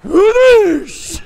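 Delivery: loud, high, laughing whoosh of flames; explosion whoosh. Retold or imitated. Teller mimicking the mesquite pile exploding, loud laughing whoosh. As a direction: blow it up with your voice.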